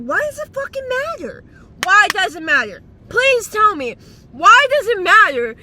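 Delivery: loud, high, exasperated why does it matter; ranting at people who fight over Instagram; exasperated yelling. Real, not acted. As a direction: demand an answer, nearly shouting.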